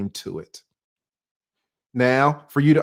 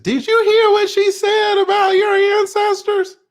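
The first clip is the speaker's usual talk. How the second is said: much higher, louder put-on accusing voice; mock challenger; 7 dB louder than usual. Vlogger voicing an imagined viewer challenging him, high and loud. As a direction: play an outraged viewer confronting him.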